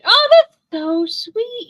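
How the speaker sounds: high, loud, drawn-out cooing; gushing delight. Real, not acted. Friend admiring a watercolor holly, gushing. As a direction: gush with real delight, stretching it out.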